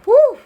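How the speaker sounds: excited whoop. Real, not acted. Shuffling cards, excited whoop.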